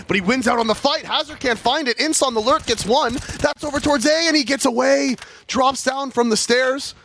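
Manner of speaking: high, loud hype call; esports caster. Real, not acted. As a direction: shout the play-by-play. Hype play-by-play.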